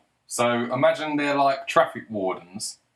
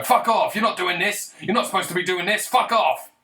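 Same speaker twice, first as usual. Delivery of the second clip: aggressive yelling; 8 semitones higher than usual. Retold or imitated. A gang yelling at a traffic warden to scare him off. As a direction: bark them off like a thug.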